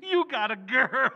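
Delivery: laughing it out loud. Acted. Father laughing at son's confession of a girl.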